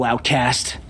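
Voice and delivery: ordinary talk, nothing marked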